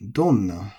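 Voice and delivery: donna with a drop after don; accented. Shown as a demonstration. Teacher repeating for learners, donna accented on don. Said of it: "is accented".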